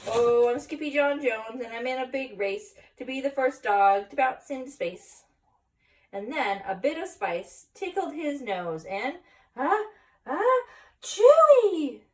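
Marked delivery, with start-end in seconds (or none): 0.1-5.2 loud, gleeful bouncing boast; excited proclaiming. Acted. Skippy bouncing into spice announces his space race, excited. 9.6-12.0 big drawn-out ah-chewy sneeze, much higher and louder. Acted. Spice tickles Skippy's nose, big exaggerated sneeze. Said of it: "The kitty boy sneezed".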